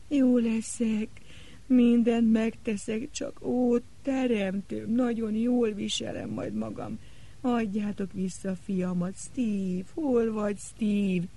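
high, slow, tearful begging; high desperate sobbing plea. Acted. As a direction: plead through sobs. Sobbing girl begs on knees.

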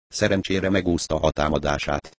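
plain reading voice

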